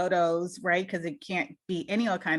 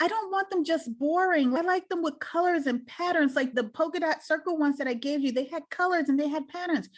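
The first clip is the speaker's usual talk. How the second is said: voicing daughter; 6 semitones higher than usual. Daughter describing pants.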